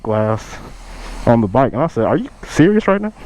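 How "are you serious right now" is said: much higher, disbelieving challenge; incredulous retort. Retold or imitated. To the buyer claiming coolant in oil, high incredulous challenge. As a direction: scoff at his claim in disbelief.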